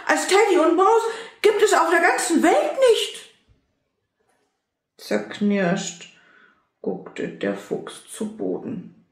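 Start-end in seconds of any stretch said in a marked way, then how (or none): 0.1-3.3 much higher and louder, with a laugh; loud, high ghost voice proclaiming friendship; ghost calling out. Acted. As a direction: cry it out joyfully as the ghost. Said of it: "Und das Gespenst rief".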